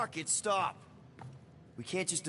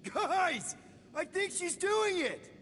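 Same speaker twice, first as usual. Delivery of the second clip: very high-pitched excited cry; high, giddy triumph; thrilled disbelief; 13 semitones higher, 3 dB quieter than usual. Acted. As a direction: squeal it in amazed delight, breaking into a laugh. Delighted that the plan works.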